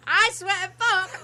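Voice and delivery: high, loud exasperated oath; hammed-up loud swearing; mock outrage. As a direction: re-enact an irritated outburst. Re-enacting his outburst at clippings.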